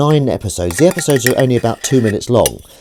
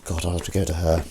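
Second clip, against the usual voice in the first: ordinary talk, nothing marked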